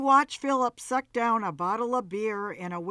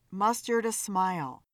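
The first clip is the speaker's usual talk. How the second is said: ordinary delivery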